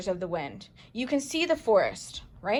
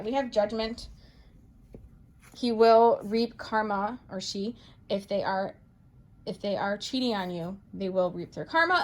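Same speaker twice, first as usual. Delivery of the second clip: usual speaking voice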